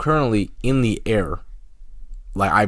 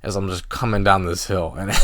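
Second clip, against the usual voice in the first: usual speaking voice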